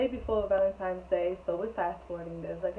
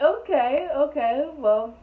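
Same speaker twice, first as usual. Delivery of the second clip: flustered okay; 6 semitones higher, 8 dB louder, slower than usual. Retold or imitated. Laughing reply, flustered.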